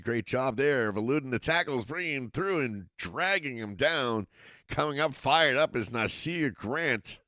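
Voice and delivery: steady live football call, rising with the action; play-by-play commentary. Acted. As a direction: call the game as it happens.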